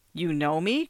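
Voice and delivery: plain reading voice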